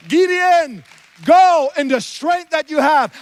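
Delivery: rising, ringing preacher's call, peaking in a shout; preaching at full force. Acted. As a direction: build to a loud climax for the congregation. Preacher exhorting the congregation.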